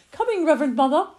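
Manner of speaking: put-on high, loud little-girl reply; child calling back. Lily answers her mother across the lawn, high childish call. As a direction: sing it out obediently.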